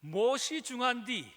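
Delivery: a light Jeolla accent, slow and emphatic; Jeolla dialect. Shown as a demonstration. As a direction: drawl 무엇이 중한디 like a Gwangju local, leaning on 무엇이. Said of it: "전라도 사투리로 이 말이 참 저는 마음에 드는데".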